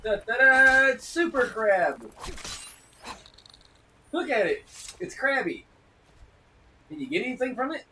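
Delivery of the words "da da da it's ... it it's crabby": loud, high fanfare then slow "super crab"; excited hype. Real, not acted. Streamer hyping a crab he found, loud high fanfare. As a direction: trumpet the crab like a hero's arrival.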